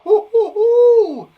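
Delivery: high, loud slow whooping; excited whoops. Real, not acted. Teacher whooping with excitement at a hard word. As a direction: whoop it up for the class.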